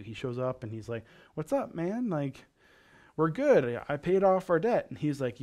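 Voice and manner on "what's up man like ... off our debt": higher, louder, casually self-assured; breezy retold greeting. Servant showing up before the king, breezy mock confidence. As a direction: play the servant who thinks all is settled.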